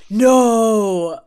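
loud, drawn-out mock wail of dismay. Mimicking a traitor's reaction every breakfast, in loud mock dismay. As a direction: exaggerate a faked cry of shock. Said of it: "watching him every breakfast do the".

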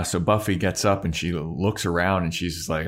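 ordinary delivery

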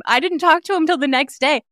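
normal talking voice

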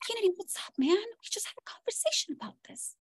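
put-on high, hushed stage whisper to a child; urgent parental whisper. Mom pleads with Kennedy mid-meltdown in public, hushed acted whisper. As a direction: whisper a reproach so bystanders won't hear.

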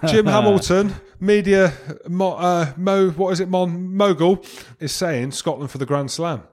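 mock headline. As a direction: announce yourself like a tabloid headline. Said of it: "Let's get a headline".

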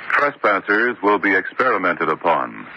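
usual speaking voice

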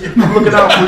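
loud, high-pitched insistence; insistent reassurance. Retold or imitated. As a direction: press the point urgently at a running pace. Insisting to the drunk player.

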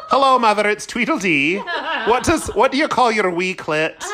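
cheekily ringing up her mother; playful phone call. Pretend. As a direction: giggly pretend call to a Scottish mother. Mock call to friend's mother.

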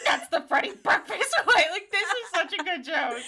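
high, giggly repeat; laughing. Real, not acted. Host savoring such a good joke, laughing. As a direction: squeak it out through laughter.

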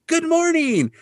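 very high, chirpy imitation; mock chirpy greeting. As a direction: greet in an exaggeratedly high, cheery voice. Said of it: "And Ron's like".

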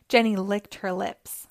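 usual speaking voice

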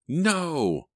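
high, sharp scream of terror; terrified scream. A narrator's reading. Emma screaming in the dark woods, terrified. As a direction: scream it out in pure fright. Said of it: "The scream rang out again".

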